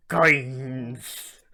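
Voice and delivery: loud, high drawn-out coin voice; put-on object voice; talking coin. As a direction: stretch it out as the coin.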